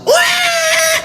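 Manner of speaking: sudden very high mock baby cry; baby's wail. Child bursting out crying during the wedding vow, mock wail. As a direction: cut in with a piercing imitation wail.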